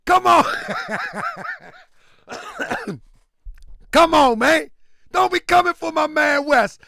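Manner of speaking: loud, high hype yelling; pumped-up shouting. Real, not acted. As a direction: cheer him on at a pumped-up shout. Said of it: "got me all pumped up".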